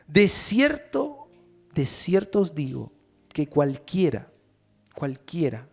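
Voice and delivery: slow, solemn reading of the verse; scripture reading. Acted. Pastor reading Jesus' words to the church, solemn. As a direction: read the Bible words with weight.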